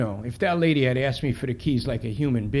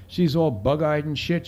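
ordinary delivery, nothing marked

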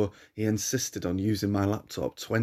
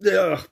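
high, louder groan of disgust; 9 semitones higher, 5 dB louder than usual. Acted. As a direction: recoil from the stench. Narrator disgusted by the stench.

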